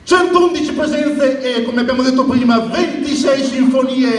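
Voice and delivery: stadium announcer goal rhyme and chant; breathless italian goal commentary. Shown as a demonstration. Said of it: "E quando segnava Alex cosa dicevi?".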